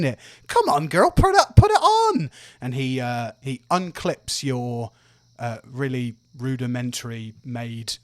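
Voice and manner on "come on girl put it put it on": high, loud coaxing cheer; eager dad. Acted. Dad urging his child to try the arm, high and loud. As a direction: egg her on with excitement.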